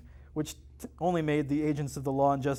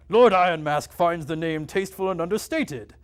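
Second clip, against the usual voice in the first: pompous villain voice; 3 semitones higher, 4 dB louder than usual. Acted. Another hooded villain.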